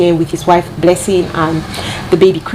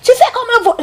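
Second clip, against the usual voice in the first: imitated screaming; 13 semitones higher than usual. Imitating the nanny screaming at Caden, shrill mock scolding. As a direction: mimic a harsh scolding in a much higher voice. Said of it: "she was screaming at, screaming at Caden".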